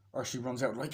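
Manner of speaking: ordinary talk, nothing marked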